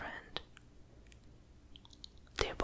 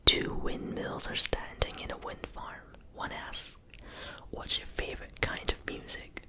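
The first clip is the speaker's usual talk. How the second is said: stress on its. Real, not acted. Whispers a joke aloud.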